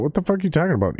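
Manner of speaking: high incredulous curse; exasperated outburst. Real, not acted. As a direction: voice jumps high in outrage at the screen. Yelling at app, pitch jump.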